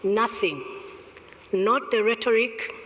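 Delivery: ordinary talk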